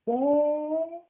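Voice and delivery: high small "yeah"; high childlike yes; meek little boy. Acted. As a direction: kid admitting it. Kid answers.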